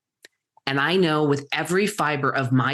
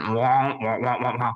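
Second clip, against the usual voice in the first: muffled wah-wah of the Peanuts teacher; lightly voiced cartoon-adult babble; 4 semitones lower than usual. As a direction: mimic a muted trumpet droning on. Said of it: "The teacher from the Peanuts".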